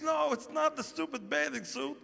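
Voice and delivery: imitating a tearful high voice; tearful complaint. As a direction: whimper it as the upset girlfriend. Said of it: "And she's still crying".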